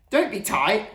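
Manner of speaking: high, loud wail of complaint; howled protest. A narrator's reading. Gamble begs mum to keep radio on, howling. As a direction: howl it in outrage. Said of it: "howled Gamble".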